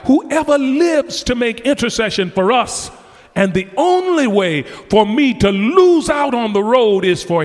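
high, loud, climbing preacher's cry; fervent pulpit preaching rising to a shout. Acted. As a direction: build the congregation toward a shout. Preaching at full force.

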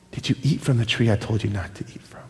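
God quietly confronting Adam; solemn question. Acted. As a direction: ask it quietly and heavily.

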